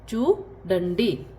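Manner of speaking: चूडंडी stretched slowly; very slow repeat. Shown as a demonstration. Teacher repeats Telugu for see very slowly. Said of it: "सी".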